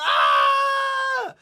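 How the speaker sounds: hammed-up high scream of pain; loud retold yell as if your arm just snapped. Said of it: "he get this screaming and holler".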